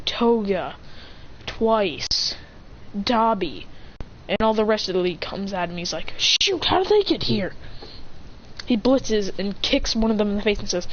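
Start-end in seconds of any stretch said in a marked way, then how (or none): 6.2-7.5 high-pitched mock alarm; raised, incredulous voice; startled hero. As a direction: react as a hero caught off guard. Acted startled hero in story.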